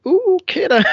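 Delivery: high loud ooh okay then; high mock-startled okay then; mock taken aback. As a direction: back off in hammed-up alarm. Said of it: "It's like".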